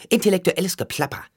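high, fast mocking scoff; scornful sneer. A narrator's reading. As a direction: jeer at his big words, quick and high. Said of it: "höhnte sie". (höhnisch und schnell)

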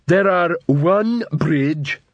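slow, high, dim troll counting bricks. A narrator's reading.

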